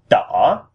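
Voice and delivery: đỏ swoops down then high up; dipping-rising tone. Shown as a demonstration. Repeating red for learners, đỏ down then sharply up. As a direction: ask đỏ as a question, dipping first. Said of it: "lowering our voice and then raising".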